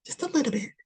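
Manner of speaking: soft whisper; whispered nudge. Acted. Reader coaxes the viewer gently, whispered. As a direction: coax in a hush.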